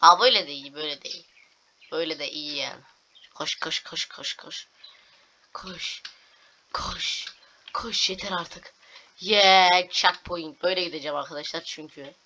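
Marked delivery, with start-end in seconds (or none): 3.3-8.7 tense whisper to run; whispered urging. Real, not acted. Gamer whispering to his character to run.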